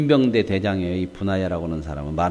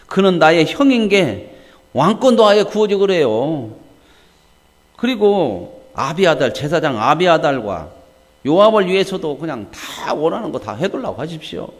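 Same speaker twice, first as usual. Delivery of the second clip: Solomon's angry retort; 7 semitones higher, 7 dB louder than usual. Acted. Solomon rebuking his mother's request, angrily. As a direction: snap back at her, indignant and mocking. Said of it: "성질을 냈어요".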